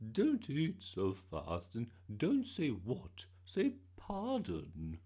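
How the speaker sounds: scolding gran. A narrator's reading. Gran correcting Bertie at the Queen's party, sternly. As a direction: spell out manners in a gran voice.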